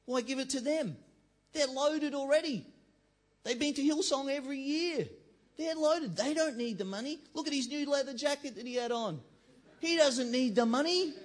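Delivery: loud, high mock outrage; indignant objection. Preacher voicing a critic of the offering, hammed-up indignant protest. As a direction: play a resentful churchgoer protesting. Acts out a resentful congregant's inner voice.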